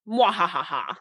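soft mock evil cackle; a playful villain's laugh; sneering panto villain. Mock evil laugh about dad.

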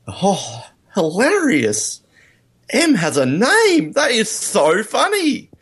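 slow, high sarcastic enthusiasm; mock amusement. Host mocking the film's repeated name joke, sarcastically high and slow. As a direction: overplay how hilarious it is, drawn out.

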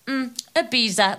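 lofty mock-posh reply; snobby. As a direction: toss it off like a jet-setter. Snob answering where it's from.

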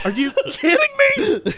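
high-pitched mock disbelief; incredulous. Bystander reacts to customer's second order, incredulous. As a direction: exclaim it in high, hammed-up disbelief.

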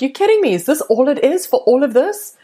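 high, astonished "you're kidding me"; amazed disbelief. Retold or imitated. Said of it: "they're like".

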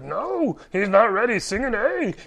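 a hint of Yoda lecturing Luke; mock Yoda refusing the pupil; imitating Yoda. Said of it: "And Yoda's like".

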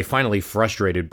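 ordinary delivery

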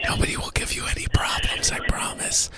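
hushed radio host whisper; breathy secretive whisper on air. Acted. Host whispers all show.

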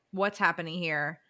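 ordinary talk, nothing marked

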